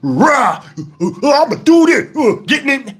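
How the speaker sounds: hammed-up posturing growls and grunts; mocking loudmouth bluster; mock tough talk. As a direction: puff up like an all-talk fighter. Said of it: "when you see people talking and".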